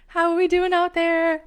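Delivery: loud, high host shout to the crowd; high, projected crowd call. Real, not acted. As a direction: holler it out to the audience. Calling out to live audience.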